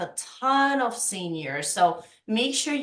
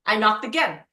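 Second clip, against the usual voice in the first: ordinary talk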